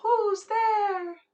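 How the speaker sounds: high, loud owl hoot, gently falling; owl crying out. Acted. Owl cries out from the elm tree, owl call. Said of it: "the owl cries out".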